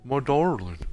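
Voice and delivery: station master's voice. Retold or imitated. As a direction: read it as the dopey station master, lightly voiced. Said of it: "I gotta do it in his voice".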